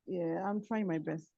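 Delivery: very faint, distant voice; weak, far-off speech; barely audible. Real, not acted. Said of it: "Can you be a little bit louder?".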